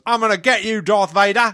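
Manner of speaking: put-on high, punchy Aussie kid; Australian accent. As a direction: play a feisty Australian kid. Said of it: "I was practicing my Australian accent too".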